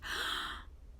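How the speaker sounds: breathy startled gasp; crowd gasp. A narrator's reading. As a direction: gasp in shock. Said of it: "The crowd gasped".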